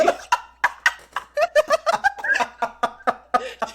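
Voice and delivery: shrieking laugh, high; high burst of laughter. Real, not acted. Co-host cracking up at the Henry joke, high laugh.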